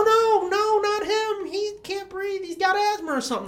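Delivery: high, panicky imitation of begging; mock pleading. Man begging attackers to spare his partner, high pleading.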